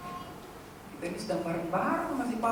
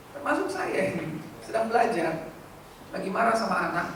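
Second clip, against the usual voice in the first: ordinary delivery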